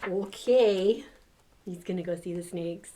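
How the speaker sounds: ordinary delivery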